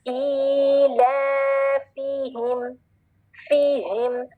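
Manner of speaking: ईलाफिहिम intoned slowly on one note; measured Qur'anic recitation of ईलाफिहिम; Arabic recitation. Shown as a demonstration. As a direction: hold the long vowels of ईलाफिहिम in Arabic style. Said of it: "अपना accent भी Arabic रखेंगे".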